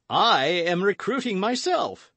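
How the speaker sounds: high, hearty, booming host; boisterous Porthos. A narrator's reading. Porthos welcoming friends to dinner, boisterous. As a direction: play an expansive, boastful big musketeer.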